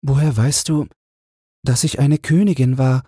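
high, gentle voice of the poor queen; the queen asks the angel, in a high gentle voice. A narrator's reading. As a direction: play the humble queen asking in wonder.

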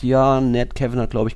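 usual speaking voice

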